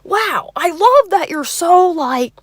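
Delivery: loud, high mock gushing admiration; overzealous Bill Cosby. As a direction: gush like a smitten, overeager date. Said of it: "overzealous Bill Cosby meets".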